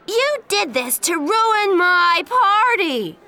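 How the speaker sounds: high, loud, slow furious shout. Acted. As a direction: scream the accusation at Jen. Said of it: "she shouted at Jen".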